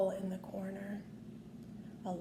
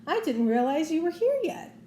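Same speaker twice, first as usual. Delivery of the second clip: high, louder cheery cartoon mouse; Mickey; 6 semitones higher, 4 dB louder than usual. A narrator's reading. Mickey, coming down in costume, greeting his friends cheerfully. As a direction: greet the gang brightly. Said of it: "Mickey said".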